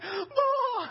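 very high, acted cry; reunion cry. Isaac comes back to his mother, high relieved cry. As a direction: call out in overwhelmed relief. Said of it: "and she's like".

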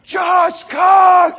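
yelled out high and loud, cheering a friend on; excited shout. Retold or imitated. Friend cheering Josh from the crowd, a loud high shout. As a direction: shout his name like he just won.

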